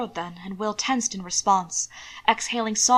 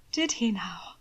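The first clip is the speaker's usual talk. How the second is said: hushed; 4 dB quieter, slower than usual. A narrator's reading. Will quietly menacing his husband.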